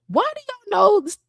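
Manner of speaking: high-pitched retold bafflement; imitating your own stunned question; baffled. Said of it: "I was baffled".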